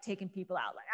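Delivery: normal talking voice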